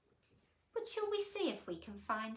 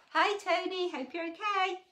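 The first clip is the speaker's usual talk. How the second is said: bright greeting; 9 semitones higher, 10 dB louder than usual. Real, not acted. Greeting a listener by name.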